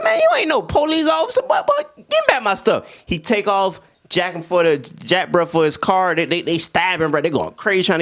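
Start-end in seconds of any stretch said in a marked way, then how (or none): 0.0-3.0 high, laughing mock protest; mock outraged friend. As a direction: squeal it like a robbed buddy. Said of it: "He like".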